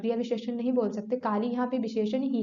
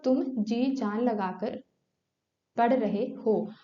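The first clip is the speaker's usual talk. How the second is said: slow dictation; much slower than usual. Shown as a demonstration. Teacher reads example sentence aloud.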